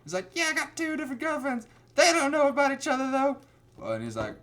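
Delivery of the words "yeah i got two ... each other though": high-pitched mock teen, getting louder; whiny teenage nephew. Nephew admitting two girlfriends to his uncle, whiny teen voice. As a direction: squeal it like a hammed-up kid.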